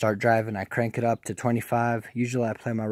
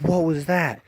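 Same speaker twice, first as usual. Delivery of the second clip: higher-pitched retold alarm; startled question; 6 semitones higher than usual. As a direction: act out being startled by a bang. Startled by bang while driving.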